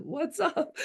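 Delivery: high-pitched, laughing quoted question; giggly mimicked catchphrase; amused imitation. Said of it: "just like Jeff said".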